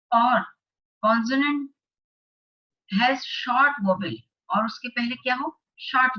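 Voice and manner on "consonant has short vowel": slow, deliberate classroom dictation; slow worksheet read-aloud. Acted. Teacher reading the worksheet aloud to class eight students, slow and deliberate. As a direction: read each phrase out slowly for the class. Reads the grammar rule.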